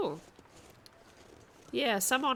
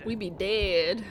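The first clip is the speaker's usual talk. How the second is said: louder echo of a drawn-out line; mimicking the clip; 7 dB louder, much slower than usual. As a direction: parrot the line back lightly. Echoing the clip's line.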